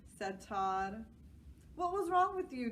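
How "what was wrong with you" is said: put-on high, loud girl's question; kid voice. A narrator's reading. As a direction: voice Joy, pitched up. Joy asks.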